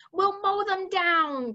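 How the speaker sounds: high, drawn-out battle cry. Acted. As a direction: cry it slowly, pitched high. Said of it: "cried the head of the army".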